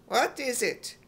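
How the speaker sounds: ordinary delivery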